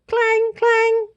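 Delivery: high, ringing clang of an alarm bell. A narrator's reading. Narrator voices the monster alarm bell ringing. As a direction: ring it out like a bell.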